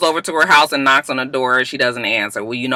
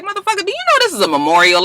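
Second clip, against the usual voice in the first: high, fast, outraged retort; mock indignant; faster than usual. The kids rebuke the boy at the memorial, high and fast. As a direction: snap back at him, fast and high.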